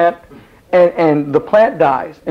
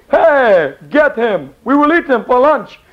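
mock king's order; 7 semitones higher, slower than usual. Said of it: "And it'd be".

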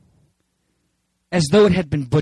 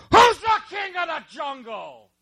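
high, put-on bully lion voice; preacher's joke lion challenging the monkey; bossy lion; 10 semitones higher, 3 dB quieter than usual. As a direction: demand it like a swaggering lion. Lion bullies jungle animals in joke.

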